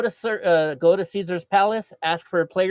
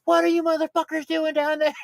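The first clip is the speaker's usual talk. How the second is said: high-pitched mock yell; Digga; 12 semitones higher than usual. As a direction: imitate someone hollering down from upstairs. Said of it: "Digga just yelling at her".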